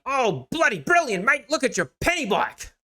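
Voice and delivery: put-on high-pitched British accent slipping into Australian; botched British accent. Time traveler marveling at a bicycle, hammed-up British-turned-Australian accent. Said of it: "Why do I always turn Australian?".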